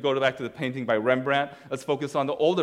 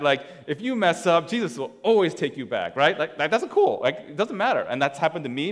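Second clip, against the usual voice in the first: usual speaking voice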